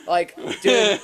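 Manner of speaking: ordinary talk, nothing marked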